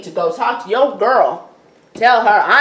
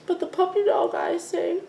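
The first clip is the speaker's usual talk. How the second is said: whiny Drew; 11 semitones higher, 6 dB quieter than usual. Retold or imitated. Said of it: "Drew is like".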